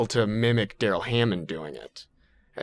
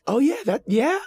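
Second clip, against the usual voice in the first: high impressed voice. Retold or imitated. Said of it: "where somebody's like".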